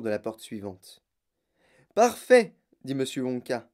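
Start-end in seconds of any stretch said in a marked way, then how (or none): 1.9-2.5 higher, louder "parfait"; brisk exclamation. A narrator's reading. Wonka halting the group at a door, exclaiming. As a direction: call it out loudly.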